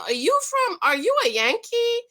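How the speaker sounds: high, curious mock Texan; Texan asking. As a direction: play a Southerner spotting a New Yorker. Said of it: "they know".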